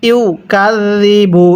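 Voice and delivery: ইউকাযযিবু with soft zal, slow recitation; drawn-out ইউকাযযিবু, zal said softly. Shown as a demonstration. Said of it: "জালটা নরম করে উচ্চারণ করবেন।". (ইউকাযযিবু নরম জাল দিয়ে ধীরে)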